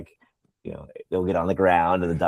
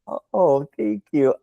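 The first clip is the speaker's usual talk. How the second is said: flustered thanks; 8 semitones higher, slower than usual. Retold or imitated. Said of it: "And I'm like".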